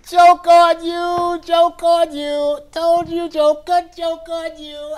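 shrieking high, loud laughing taunt; hammed-up mocking boy, slow stretched words; gloating prankster. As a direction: jeer at them through big cackles. Boy gloats at villagers, acted.

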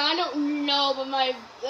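ordinary talk, nothing marked

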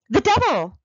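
shrill high scream of fright. A narrator's reading. Pious old lady shrieking at the devil's touch. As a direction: shriek it out, startled. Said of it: "screamed the pious old lady".

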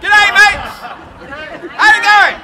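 loud proclaiming greetings; town crier. Acted. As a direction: greet each nation like a crier.

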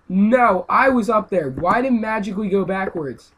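high, loud indignant ranting; real gaming rage. Real, not acted. Gamer protesting the buggy went backwards, loud rant. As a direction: rant at the game for cheating. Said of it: "this is me raging".

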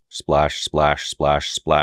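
voiced splash effect; vocal splash sounds. Shown as a demonstration. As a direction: say splash like water hitting. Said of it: "created using nothing more than my own voice".

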